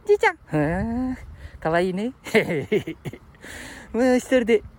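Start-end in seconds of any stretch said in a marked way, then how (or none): 0.1-0.4 high-pitched puppet voice calling out; high little character voice; cheeky puppet sidekick. Pretend. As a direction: call out as a small, high dummy. Puppet calls to Grandpa.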